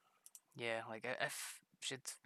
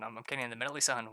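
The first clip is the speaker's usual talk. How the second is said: ordinary talk, nothing marked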